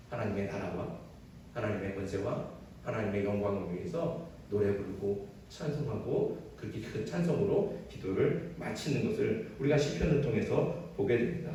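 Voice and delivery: ordinary talk, nothing marked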